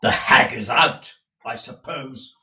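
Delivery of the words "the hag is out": loud acted remark. Ralph speaks after fruitless search.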